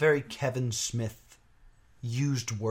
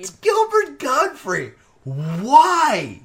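high, loud disbelief; can't believe the casting; incredulous; 12 semitones higher, 10 dB louder, much slower than usual. Real, not acted.

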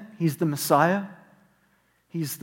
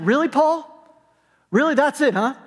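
higher, disbelieving protest; incredulous; 7 semitones higher than usual. Retold or imitated. Preacher voicing his own doubt at Paul, higher and incredulous. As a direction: push back at Paul in mock disbelief. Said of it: "And I was like".

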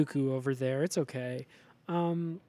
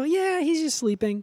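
a much higher, airy brush-off; breezy heroes voice; 11 semitones higher, 5 dB louder than usual. Retold or imitated. Heroes brushing off Lucy Liu's worry, high and breezy. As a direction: toss it off lightly in a high voice. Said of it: "And they go".

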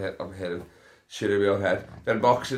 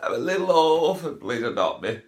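high, wailing fake-crying orphan plea; mock crying orphan; 9 semitones higher, 5 dB louder than usual. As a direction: ham up a sobbing little orphan. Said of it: "But [name]".

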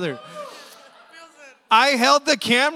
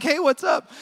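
bright, higher, called-out hey what's up; 6 semitones higher than usual. Retold or imitated. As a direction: call it out across the parking lot. Walking straight towards staring man.

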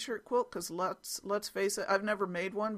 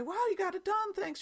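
high-pitched imitation of delighted thanks; pitched well up, pleased; grateful daughter; 13 semitones higher than usual. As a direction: voice an imagined thrilled daughter. Imagined grateful reply from daughter.

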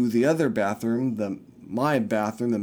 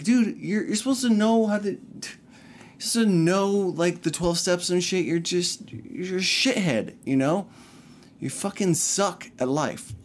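higher, exasperated scolding as he retells it; retold angry rant; 6 semitones higher than usual. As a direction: tell him off, fed up and disbelieving. Scolding the drug-test staffer.

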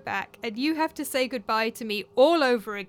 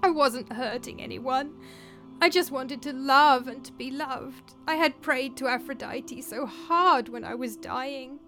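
anguished; 5 semitones higher, 4 dB louder than usual. A narrator's reading. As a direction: plead for understanding, close to tears. Ghost daughter's tearful plea to parents.

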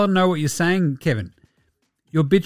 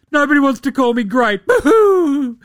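mock whining crybaby; 6 semitones higher than usual.

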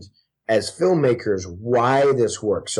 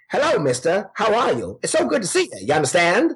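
high, hammed-up old-movie mid-Atlantic accent; 3 semitones higher than usual. Mimicking how everyone talks in old forties films, mock mid-Atlantic accent. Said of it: "sort of mid-Atlantic accent thing".

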